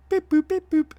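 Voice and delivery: high beeping robot noises; robot beeps. Retold or imitated. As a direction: chirp like a little robot.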